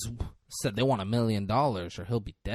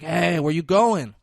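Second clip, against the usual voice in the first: loud, higher, stretched-out challenge; calling after someone; 5 semitones higher, 6 dB louder than usual. Retold or imitated. Acting out a player stopping the cop who heads out, loud call. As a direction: call him back to the table, slowly.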